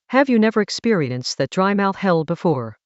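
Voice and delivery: usual speaking voice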